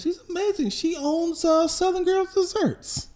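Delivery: very high, hammed-up praise; gushing wife. Wife bragging about his mom, gushing. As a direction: brag in a put-on high voice.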